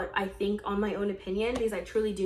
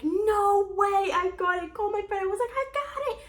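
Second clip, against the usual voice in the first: thrilled squeal; 11 semitones higher than usual. Retold or imitated. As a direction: squeal it out, can't believe it. Learns she got the job.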